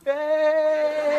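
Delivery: high, drawn-out cheer; mock family squeal of delight. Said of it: "his family's in the window going".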